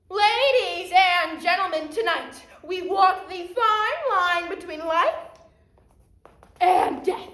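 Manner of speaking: loud, high ringmaster opening; stage announcer. Acted. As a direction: project to the whole house with a dramatic pause. Magician opens the show.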